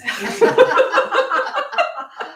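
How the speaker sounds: a real laugh, high and loud; high burst of laughter. Real, not acted. Woman laughing at the gossip story, loud high laughter.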